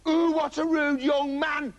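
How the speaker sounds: high, drawn-out granny; affronted granny's high wail. A narrator's reading. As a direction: scold slowly in a high old-lady voice. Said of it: "said a granny".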